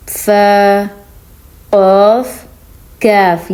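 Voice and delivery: each letter drawn out slowly and clearly; slow letter recitation. Shown as a demonstration. Teacher listing the letters of ikhfa for students.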